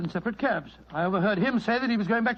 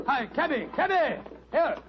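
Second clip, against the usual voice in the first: calling out; 5 semitones higher than usual. Acted. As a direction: shout for the cabby across the street. Calling a cab in the street.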